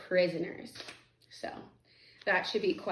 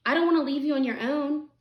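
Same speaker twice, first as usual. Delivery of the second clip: put-on higher-pitched boy's worried plea; concerned protest; 6 semitones higher, 3 dB louder than usual. A narrator's reading. Harvey to grieving Missus Griffin, concerned protest. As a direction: lift the voice, reluctant to go.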